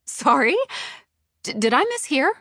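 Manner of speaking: high, loud incredulous questions; incredulous, rising pitch; stunned disbelief. A narrator's reading. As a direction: ask it aghast, stumbling on the start. Stunned by boss's admission.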